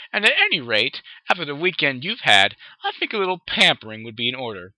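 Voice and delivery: high, loud, refined insistence; dainty, emphatic character voice; ladylike Rarity. A narrator's reading.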